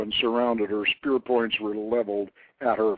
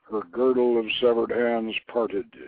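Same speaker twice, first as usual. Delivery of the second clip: plain reading voice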